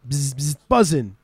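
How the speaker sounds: imitated bee buzz, then BUZZIN'; bee noise. Shown as a demonstration. Host teaching the Welsh slang word, imitating a bee's buzz. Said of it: "make the noise".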